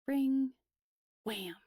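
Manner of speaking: ordinary delivery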